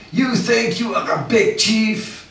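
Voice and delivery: high, slow mock French accent; imitating a yelling French devotee. A devotee jumps out of a room, mock French scolding. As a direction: mimic the stranger scolding you. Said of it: "he just goes".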